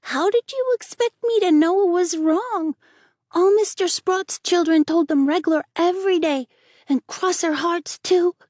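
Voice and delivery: put-on high, loud tearful little boy; crying boy. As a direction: plead through tears, sorry and hurt. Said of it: "cried stormily".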